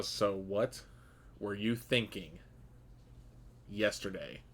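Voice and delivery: soft, cold, controlled interrogation; fury held under a calm voice; quiet seething anger. Acted. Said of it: "you can tell that he is seething".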